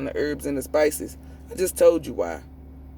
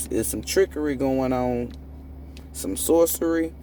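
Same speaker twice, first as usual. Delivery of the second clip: usual speaking voice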